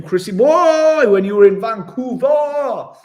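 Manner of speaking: high, loud, drawn-out call of a nickname; hammed-up excited cheer reading a mate's message; excited shout. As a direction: belt out the nickname like an excited mate. Reading a viewer's chat message.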